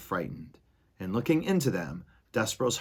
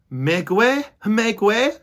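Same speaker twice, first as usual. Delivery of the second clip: loud, high call to make way; Furlow shouting; 12 semitones higher, 9 dB louder, slower than usual. Acted. Furlow shouting through the mice. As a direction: shout to clear the crowd. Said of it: "shouted Furlow".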